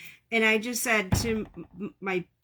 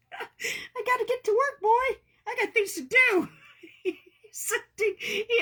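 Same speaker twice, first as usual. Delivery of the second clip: high cooing pet talk; 12 semitones higher than usual. Real, not acted. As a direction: coo at the cat, much higher. Talking to the cat.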